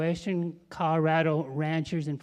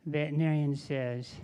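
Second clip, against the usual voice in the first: ordinary talk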